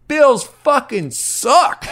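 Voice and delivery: slow, high mocking heckle; imitating a stranger's heckle; heckler voice. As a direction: drawl it out like a street heckler. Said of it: "He goes".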